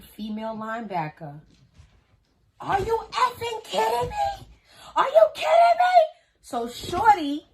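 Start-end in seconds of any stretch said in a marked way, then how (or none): 2.6-6.0 high, loud, crying yell; loud crying yell of disbelief; shouting in disbelief. Retold or imitated. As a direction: scream it out in outrage. Screaming at the other woman.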